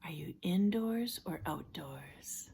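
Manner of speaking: soft, unhurried relaxation-coach voice with long pauses; guided imagery. Acted. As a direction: gently guide them into a safe place, hushed and calm. Leads a guided imagery exercise.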